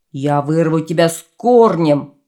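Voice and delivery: loud, furious threat; angry shout. Acted. Dragon threatens the poppy, loud angry shout. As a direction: roar it at the poppy in rage. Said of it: "закричал он в гневе".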